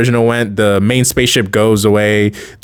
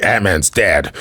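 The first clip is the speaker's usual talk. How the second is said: serious; 5 semitones lower, slower than usual. Retold or imitated. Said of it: "he gets all serious and stuff".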